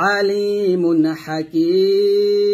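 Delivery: Hakim drawn out very slowly at the stop; slow tajweed recitation; Quran recitation. Shown as a demonstration. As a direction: chant আলিমুন Hakim, holding the end. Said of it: "এরকম ভাবে".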